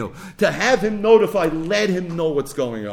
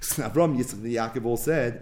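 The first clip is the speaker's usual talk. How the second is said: ordinary talk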